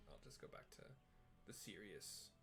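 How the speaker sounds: usual speaking voice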